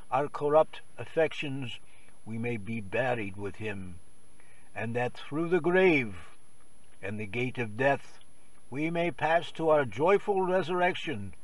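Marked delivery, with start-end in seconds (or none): none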